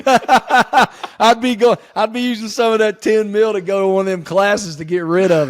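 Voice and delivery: loud, high, amused through laughter; laughing. Real, not acted. Joking answer amid shared laughter.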